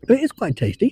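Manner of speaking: ordinary talk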